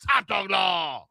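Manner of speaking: ad tagline. Retold or imitated. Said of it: "over the top".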